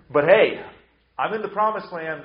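loud, breezy 'hey' in a mock boast; mock self-assurance. Preacher voicing an Israelite in the promised land, mock self-assured. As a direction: act out a cocky excuse, starting loud.

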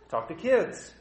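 normal talking voice

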